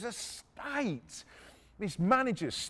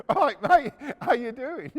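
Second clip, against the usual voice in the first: much louder, higher cheeky hello; smug greeting; 5 semitones higher, 9 dB louder than usual. Retold or imitated. Mates greeting the furious Italian owner, smug cheery hello. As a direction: greet him like an old pal to wind him up.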